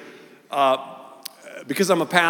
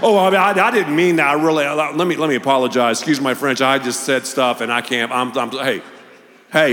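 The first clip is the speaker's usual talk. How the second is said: flustered backpedaling; 6 dB louder than usual. Retold or imitated. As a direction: scramble to take it back. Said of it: "You could see the rewind".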